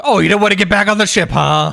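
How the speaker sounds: loud, quick mocking quote; mock taunt. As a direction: taunt him like a bullying guard. Acted guards taunting the runaway.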